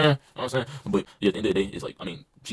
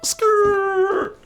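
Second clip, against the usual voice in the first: very high, loud car-screech noise; tyre screech; 7 dB louder than usual. Retold or imitated. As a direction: screech like braking tyres.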